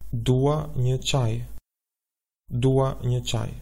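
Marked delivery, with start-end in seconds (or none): 0.1-1.5 slow, deliberate Albanian phrase; slow model answer. Shown as a demonstration. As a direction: spell it out slowly for learners. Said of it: "How would you say".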